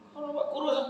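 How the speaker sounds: high sing-song tease; teasing friend. Acted. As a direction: tease him he looks thinner.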